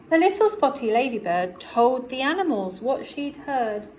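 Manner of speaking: plain reading voice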